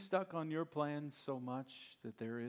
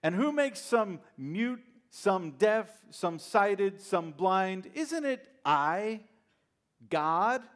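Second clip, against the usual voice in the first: louder, higher, slow and rising; God losing patience, each word driven home; God rebukes Moses, loud and exasperated; ticked-off God; 7 semitones higher, 11 dB louder, slower than usual. Acted. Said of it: "God's starting to get a little ticked".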